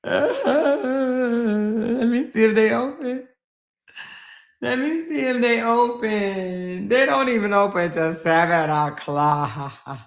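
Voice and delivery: high, drawn-out mock whine; whiny, slow fake cry over bad news; mock wailing. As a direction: stretch the words into a pretend wail. Upset that the place is closed.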